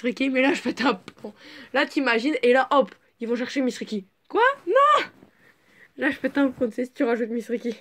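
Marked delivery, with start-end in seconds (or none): 4.3-5.0 much higher, loud outraged cry; real shock at the twist. Real, not acted. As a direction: yelp it in disbelief.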